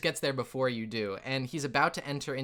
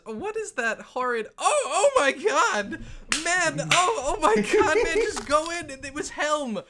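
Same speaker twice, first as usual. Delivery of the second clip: high, loud, gagging disgust; disgusted dwarf; 12 semitones higher, 6 dB louder than usual. Acted. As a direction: recoil from the stench, shrieking. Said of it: "but he says".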